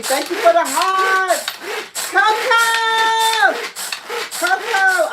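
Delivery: high, loud, drawn-out holler; excited shout-out. Real, not acted. Host thanking viewer Coco for hearts, shouting excitedly.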